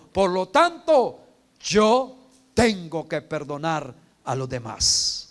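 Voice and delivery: slow, loud, weighty declaration; slow emphatic recitation. Shown as a demonstration. Preacher modelling a creed for the congregation, slow and loud. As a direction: spell out the creed slowly for the church to repeat. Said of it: "Vamos, pues, dígalo".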